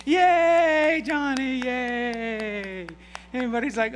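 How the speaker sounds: put-on high, loud cheer for the boy; mock crowd cheering Johnny. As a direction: whoop it up like a crowd. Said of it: "everybody says".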